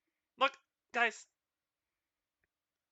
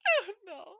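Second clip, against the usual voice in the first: laughing; 11 semitones higher than usual. Real, not acted.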